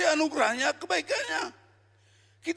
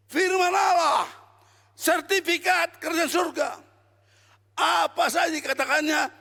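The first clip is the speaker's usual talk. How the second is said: raised, ringing pulpit voice; full-force sermon delivery; fiery preaching; 3 semitones higher than usual. Acted. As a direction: preach it out to the whole room. Preaching to the congregation.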